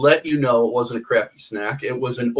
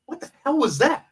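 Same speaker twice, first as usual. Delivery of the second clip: startled; 13 semitones higher than usual. Retold or imitated. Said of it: "I'm like".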